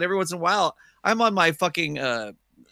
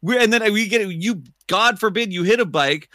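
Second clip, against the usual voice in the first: ordinary talk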